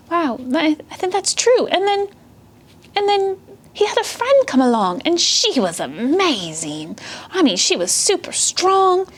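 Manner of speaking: put-on high, childlike puppy voice full of wonder; young puppy Thea, amazed. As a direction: gush as an impressed little puppy. Plays Thea, a puppy, excited.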